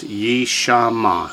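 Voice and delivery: "Yishama" drawn out syllable by syllable; slow, deliberate model of "Yishama"; three full syllables. Shown as a demonstration. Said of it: "Three full syllables".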